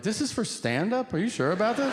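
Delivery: high-pitched, disbelieving double question; incredulous questioning. Retold or imitated. Comic offered a prime minister meeting, acting incredulous.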